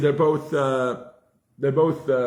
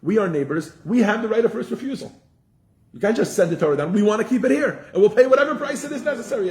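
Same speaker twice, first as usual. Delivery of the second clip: higher, laughing angels pressing their claim; angels claiming rights; 7 semitones higher than usual. Retold or imitated. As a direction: argue like eager neighbors ready to pay anything. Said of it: "They said".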